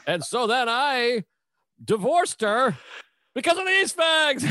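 mock manic comedian dragging out the setup; hammed-up stand-up voice breaking into laughter; Robin Williams bit. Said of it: "and he's like".

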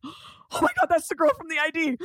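gasped, high-pitched alarm; shocked. Retold or imitated. Club-goer recognizing the girl from the ID, shocked. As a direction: blurt it high after a sharp gasp.

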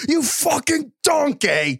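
high, slow mock chef rage; reacting to Brock Purdy's mistakes; chef insult impression. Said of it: "Craig and I are like".

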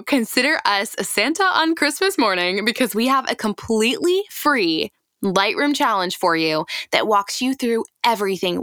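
upbeat promo pitch to photographers; host pitching a free Lightroom challenge, upbeat ad read. Acted. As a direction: sell the free challenge with bright energy.

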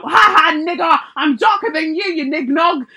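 loud, high hammed-up jeer; mock gloating taunt. Mimicking a two-faced person once the sun comes out, loud gloating. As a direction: crow it out in mock triumph.

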